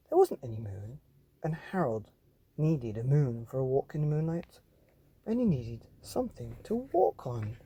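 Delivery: lively bedtime story reading; animated read-aloud for children; story narration. Acted. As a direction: read it like a picture book to kids. Reads the picture book aloud.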